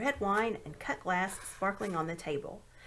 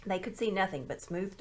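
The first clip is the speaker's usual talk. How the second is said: ordinary delivery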